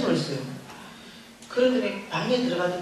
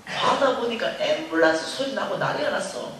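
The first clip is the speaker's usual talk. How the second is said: ordinary talk, nothing marked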